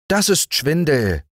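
high ant voice with a touch of scorn; scoffing ant. A narrator's reading. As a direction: dismiss it as a swindle, ant-style. Ant accusing.

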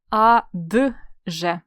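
"А, д, же" piece by piece; spelled out in parts. Shown as a demonstration. Teacher showing the right spelling, "А, д, же" sounded out. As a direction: break "А, д, же" apart with a distinct д. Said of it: "Правильно".

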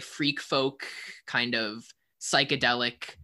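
ordinary delivery

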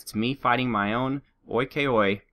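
ordinary delivery

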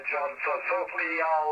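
ordinary delivery

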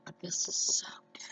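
breathy whisper with a full mouth. Real, not acted. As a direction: whisper between bites. Whispered while eating.

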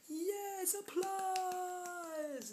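high, held-out mock-grand announcement; sing-song self-congratulation, high; grand showboating. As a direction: call for applause like a hammy star. Begging an imaginary audience for applause.

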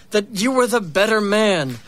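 usual speaking voice

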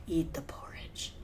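whispered, coaxing the victim; scheming whisper. Pretend. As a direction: murmur it like a sly poisoner. Willing the wizard to eat.

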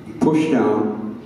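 ordinary talk, nothing marked

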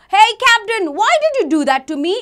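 loud, high mock retort; indignant protest. Boy challenging the captain who kicked him, loud acted indignation. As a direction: confront him loudly, hammed-up.

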